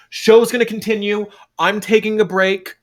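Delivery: announcing to the crowd. Acted. Fable addressing the crowd.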